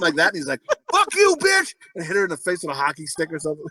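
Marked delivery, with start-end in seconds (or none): 0.9-1.7 mock yell. Husband yelling at his wife, hammed-up yell. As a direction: bark it at her.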